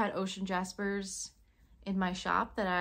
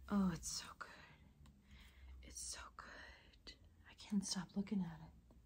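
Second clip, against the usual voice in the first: hushed, breathy admiration; awed whisper; 2 semitones lower, 11 dB quieter than usual. Real, not acted. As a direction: whisper it, spellbound. Admiring sunstone slab.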